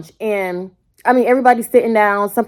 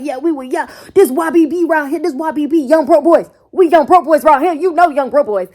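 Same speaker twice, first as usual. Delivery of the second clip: mock bragging clique; 7 semitones higher, 5 dB louder than usual. Mocking boasting clique.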